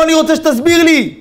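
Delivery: high, strained shouting; agitated outburst. Retold or imitated. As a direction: act out an upset man demanding an explanation. Acted emotional outburst of cab driver.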